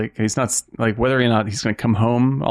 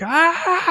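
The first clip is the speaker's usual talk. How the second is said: pained cry. Retold or imitated. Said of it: "he's just like".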